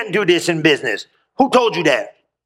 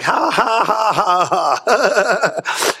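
big drawn-out ha-ha laugh; re-enacted laughing; much slower than usual. Preacher re-enacts his fit in the kitchen, hammed-up laughing. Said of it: "I started laughing right there in the kitchen".

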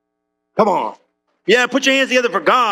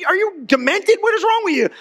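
much higher, incredulous imitation of the passengers; mock alarmed passengers; 10 semitones higher than usual. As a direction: ask it like they think he has lost it. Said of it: "They're looking at me like".

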